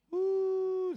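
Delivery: a high, sudden preacher's whoop; high woo. Real, not acted. A pastor whooping at a word for someone here. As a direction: let out a joyful shout.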